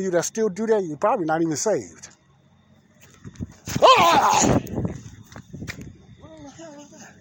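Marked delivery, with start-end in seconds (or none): 3.8-4.6 sudden loud yell of fright, pitched high; startled holler. Real, not acted. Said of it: "I swung at it so hard I hollered". 6.2-7.1 shaken, higher and louder, still rattled; real fright. Real, not acted. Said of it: "that scared the daylights out of me".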